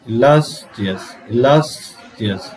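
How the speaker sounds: "illustrious" very slow, "lus" held long; drawn-out stressed LAS. Shown as a demonstration. Teacher drilling students, slow "illustrious" with a long stressed "lus". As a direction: stretch "lus", then finish "illustrious" after it. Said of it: "টেনে উচ্চারণ করতে হবে".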